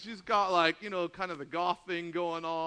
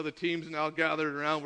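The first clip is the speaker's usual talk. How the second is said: usual speaking voice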